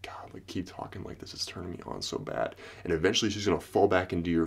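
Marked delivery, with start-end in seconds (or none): 0.0-2.6 breathy turned-on whisper; seductive whisper.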